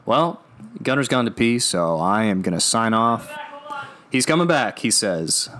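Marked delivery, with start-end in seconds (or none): none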